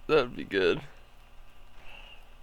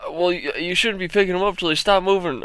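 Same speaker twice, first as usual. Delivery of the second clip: higher, louder mock complaint; mock whiny customer; 5 semitones higher, 5 dB louder than usual. Customer blaming the game worker, mock whiny complaint. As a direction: whine it like a sore loser.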